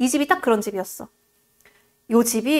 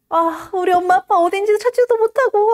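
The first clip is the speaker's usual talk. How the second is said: mock crying lament; 7 semitones higher, 3 dB louder than usual. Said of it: "막 울면서 잠이 들어요".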